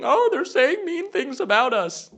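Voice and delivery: high, slow, whiny mockery of a complaining politician; mock whiny screaming. Host mocks J D Vance complaining, in a high whiny voice. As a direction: whine it like a crybaby. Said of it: "J D Vance is screaming".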